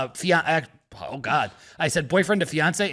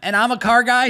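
ordinary delivery

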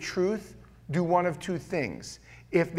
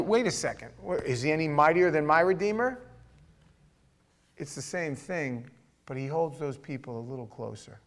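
ordinary delivery

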